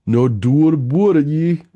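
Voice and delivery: hammed-up Canadian "boot"; bad Canadian accent. Streamer joking with cohost, in a bad Canadian accent. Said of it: "I'm not very good Canadian".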